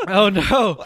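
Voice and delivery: high laughing oh no; cracking up mid-word. Real, not acted. Laughing at being called.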